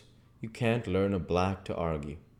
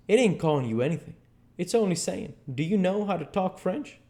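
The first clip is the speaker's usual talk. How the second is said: higher, quick-starting dialogue voice; Huck, impatient; 10 semitones higher than usual. Acted. Huck explains the French phrase to Jim, impatient. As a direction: set him straight, pitched up.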